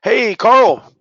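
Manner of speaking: bright, high, loud greeting; enthusiastic. Shown as a demonstration. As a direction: call out his name warmly and eagerly. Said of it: "I'm just gonna role play".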